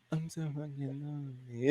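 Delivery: whispered under the breath. Retold or imitated. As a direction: mouth the words quietly. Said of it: "trying to say it under her breath".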